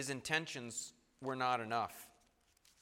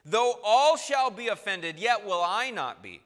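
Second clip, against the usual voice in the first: louder, higher, defiant promise; Peter's bold vow; 6 semitones higher, 10 dB louder than usual. Acted. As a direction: swear it out loud as brash Peter. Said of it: "Peter said".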